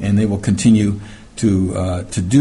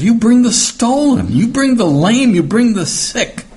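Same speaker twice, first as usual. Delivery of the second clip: rising rebuke; 11 semitones higher, 3 dB louder than usual. Acted. Reading God's rebuke to priests.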